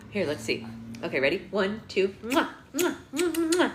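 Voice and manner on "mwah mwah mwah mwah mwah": kiss sounds. Real, not acted. Kissing the baby.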